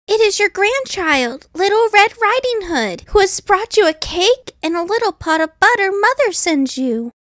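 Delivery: put-on sweet, trusting young girl; little girl voice. A narrator's reading.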